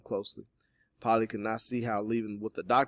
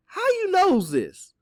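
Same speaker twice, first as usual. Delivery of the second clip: very high, slow suspicious question; suspicious, high-pitched challenge; 11 dB louder, much slower than usual. A narrator's reading. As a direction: stretch it out loud and distrustful. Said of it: "Teeny asked suspiciously".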